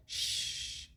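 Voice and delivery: soft unvoiced shh; shushing. Shown as a demonstration. Teacher imitates Aria's shushing gesture. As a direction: hush someone like Aria on the cover. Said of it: "como la portada cuando hace Aria".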